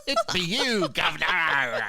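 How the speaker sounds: high, loud hammed-up English villager; mock English accent. Villager recognizing the newcomer, loud mock English accent. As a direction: holler in cartoon English dialect. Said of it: "Though they're in England".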